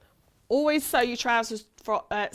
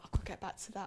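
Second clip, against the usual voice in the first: ordinary talk, nothing marked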